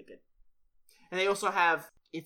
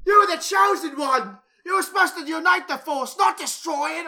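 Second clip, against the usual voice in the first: shouted movie quote; 12 semitones higher, 12 dB louder than usual. Retold or imitated. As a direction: yell it like a betrayed mentor. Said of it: "or whatever he said".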